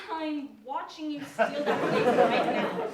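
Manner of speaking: exasperated accusation. Acted. Exasperated accusation, audience laughing.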